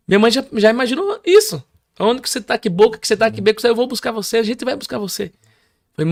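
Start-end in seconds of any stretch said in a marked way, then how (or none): 2.0-5.3 higher-pitched, urgent questioning; pressing, raised-pitch retold plea; anxious mother. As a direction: imitate a worried mom firing questions.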